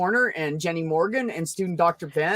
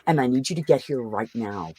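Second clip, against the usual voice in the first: calm phone voice; 7 semitones lower, 6 dB quieter than usual. Retold or imitated. Calling nine one one near the man, hushed calm. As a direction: talk low so he can't hear you. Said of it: "I'm gonna talk very calmly".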